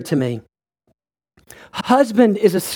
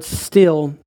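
"still" set apart, lower and drawn out; "still" spoken slowly and heavily, on a low falling tone; emphasised word; 5 semitones lower than usual. Shown as a demonstration. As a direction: dwell on "still" and let it fall. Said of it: "There is an emphasis of this word".

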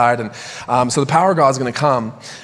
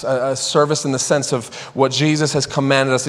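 normal talking voice

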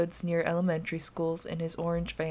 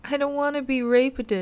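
put-on crying child; 7 semitones higher, 3 dB louder than usual. A narrator's reading.

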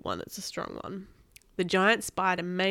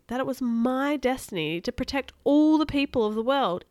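ordinary delivery, nothing marked

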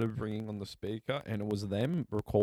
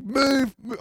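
loud, high-pitched shout to clear the way; retold warning yell; 14 semitones higher, 9 dB louder than usual. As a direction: yell at people in your path. Retold yelling from pallet jack.

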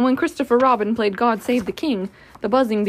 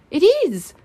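higher, louder cry of discovery; excited realisation; 8 semitones higher, 7 dB louder than usual. Acted. Owl realising he has found the bees, excited. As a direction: burst out with sudden certainty.

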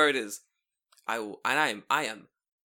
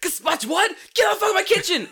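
much higher, much louder chef shout; Gordon Ramsay shout; 11 dB louder than usual. Retold or imitated. Parodying the celebrity chef, hammed-up shout. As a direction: throw the cook out, roaring. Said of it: "it's Gordon Ramsay".

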